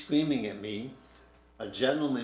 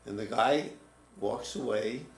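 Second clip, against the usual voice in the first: usual speaking voice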